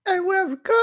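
very high falsetto sing-out. Acted. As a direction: draw it out in a camp, high falsetto.

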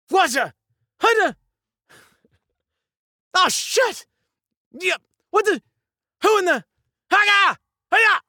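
high, loud bursts of alarm; startled shouts. Acted. Actor trying reaction takes, startled high shouting. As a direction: blurt each one as if jumped.

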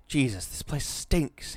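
slow, high cry of disgust. A narrator's reading. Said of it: "he cried".

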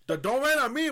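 mock outburst. Imagined rant at cashier.

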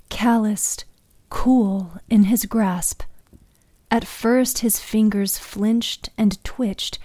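ordinary delivery

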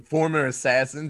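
ordinary talk

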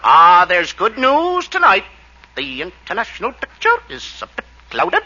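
slow newscaster delivery. Shown as a demonstration. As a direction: read it like a radio news bulletin. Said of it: "just one more".

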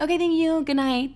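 high, quick-to-please sign-off; relieved thanks. Retold or imitated. Daughter satisfied after mom complies, relieved thanks. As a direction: thank them in a lifted little voice.

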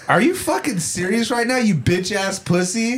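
high-pitched mock disbelief; retold indignant taunt. Retold insult during quarrel.

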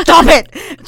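loud, high, exasperated command; mock yell. Co-host retells typing at her meddling friend, mock yell. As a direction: blow up in comic outrage. Typed argument escalates.

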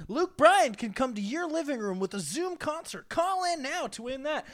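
radio ad voice. Retold or imitated. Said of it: "an ad that goes".

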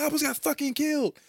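high mock rattled friend; shaken friend. Retelling to friends, the buddy nearly killed, high mock voice. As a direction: squeak it out in mock panic. Retold scene.

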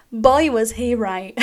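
slow words through a laugh. Real, not acted. Joking to listeners about [name], slowly, laughing. Laughs at her own joke.

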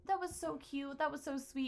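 a hint of grown kids reminiscing sweetly; fond grown-up kids. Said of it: "remember when they're older".

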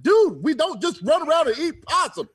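high-pitched incredulous protest; exasperated retort. Retold or imitated. Retort to friend's possum question.

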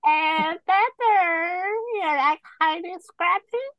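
put-on high squeaky old lady voice; talking to a dog. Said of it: "It's like an old lady voice".

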